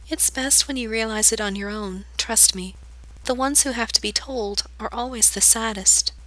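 put-on solemn girl consoling the dead boy, softly; sad girl. A narrator's reading. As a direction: comfort him gently. Sad girl comforts Gerard.

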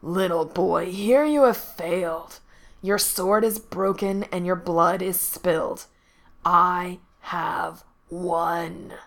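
slow, drawn-out triumphant menace; a monster savoring its victory; gloating demon voice. A narrator's reading. Demon gloats over the boy.

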